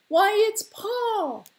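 high, loud, drawn-out cry; astonished shout. Acted. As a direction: shout it in amazed recognition. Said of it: "the shouts went up".

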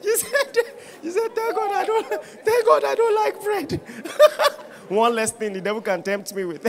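high-pitched laughing through the words; laughing helplessly. Real, not acted. Pastor cracking up retelling his wife's message. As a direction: laugh so hard the words squeak out. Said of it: "before I laugh for you".

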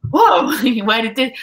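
high, loud laughing gasp of surprise, imitating himself; delighted disbelief. Reporter reliving his job offer, with laughing amazement. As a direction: act out striking gold.

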